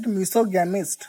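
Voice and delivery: misogamist said as miso-GAM-ist, with a full a in gam; common mispronunciation. Shown as a demonstration. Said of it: "most of the people pronounce it wrong".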